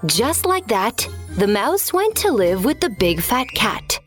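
ordinary delivery

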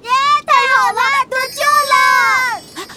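loud, high joyful cheer. Acted. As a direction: whoop with relief at being saved. Rescued from the sinking ship.

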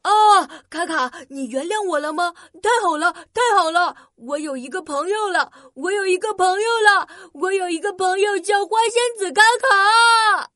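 overjoyed shouting robot; excited. Acted. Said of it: "她高兴地原地转起圈来".

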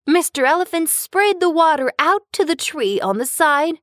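plain reading voice

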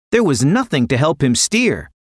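plain reading voice

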